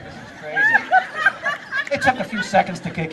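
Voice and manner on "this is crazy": high squeaky laugh through the words; can barely get it out for laughing; slow, breaking into giggles. Real, not acted. Hypnotist cracking up at stage.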